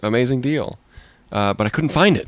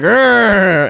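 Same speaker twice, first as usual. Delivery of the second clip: loud, much higher hammed-up "Arr"; mock monster roar; 8 semitones higher, 5 dB louder than usual. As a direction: roar like a cartoon monster crashing in. Frankenstein roars back.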